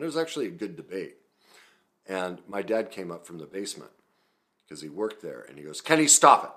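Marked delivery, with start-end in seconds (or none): 5.8-6.5 loud, high retold scolding; sudden acted shout of reprimand; angry father. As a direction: imitate a dad yelling an order across the house.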